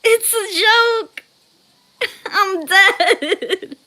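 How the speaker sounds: high, loud protest collapsing into giggles; laughing. Real, not acted. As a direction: crack up while saying it. Cracking up at chat.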